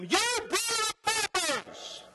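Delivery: high, strained, drawn-out yell; loud preaching yell. Shown as a demonstration. John the Baptist rebuking the crowds, yelled high. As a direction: hurl it at the crowd as a fierce rebuke. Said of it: "That's how he said it".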